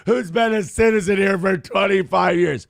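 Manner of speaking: high, loud mocking whine; mocking whiny liberal. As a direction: mimic an earnest activist. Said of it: "on the left being like".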